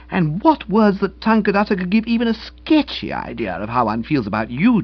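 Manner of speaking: lightly voiced gushing feminine praise; effusive gratitude to a servant; admiring young woman. Young woman praising the valet, effusive.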